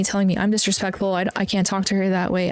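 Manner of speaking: ordinary delivery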